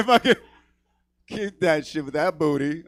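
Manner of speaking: ordinary talk, nothing marked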